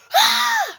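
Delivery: a loud, high sudden gasp of waking; sharp gasp. Shown as a demonstration. Showing how she woke from the dream, a loud sharp gasp. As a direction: gasp like jolting awake from a nightmare. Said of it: "And I wake up like this".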